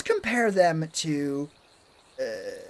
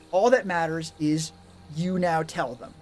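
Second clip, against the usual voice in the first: normal talking voice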